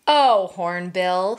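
usual speaking voice

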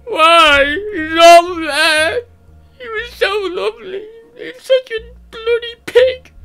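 high, loud, slow hammed-up sobbing wail; mock bawling. Imitating a dumped friend bawling over her ex, loud high wail. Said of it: "you're crying and you're bawling".

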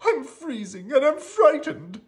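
high, loud miserable character voice; acted cold and fearful squeal; frightened. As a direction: whimper out high that you're scared. Cold, frightened Rocky after sneezing.

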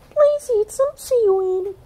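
very high small voice; a grateful sister; high squeaky thanks; sweet sister Pai Hai. A narrator's reading. Lani offers food.

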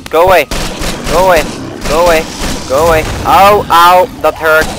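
high, loud frantic shouts; scared and overwhelmed; panicked yelling. Real, not acted. Being attacked by zombies.

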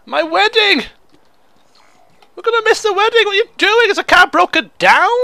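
high, frantic panic about missing the wedding; mock panic. Gamer voicing the couple, in high frantic panic. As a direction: squeal it like the bride running late.

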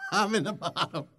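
talking through a laugh; chuckled punchline. Real, not acted. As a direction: laugh at your own joke as you say it. Laughs at own sinking joke.

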